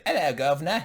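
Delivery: loud mock English accent. Shown as a demonstration. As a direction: put on a cartoonish English accent. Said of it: "Let me hear your best English accent".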